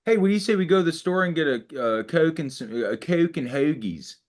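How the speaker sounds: quick, lightly voiced Mid-Atlantic accent; a hint of Baltimore Mid-Atlantic. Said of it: "the Mid-Atlantic accent is".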